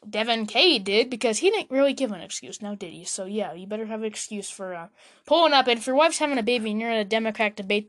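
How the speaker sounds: normal talking voice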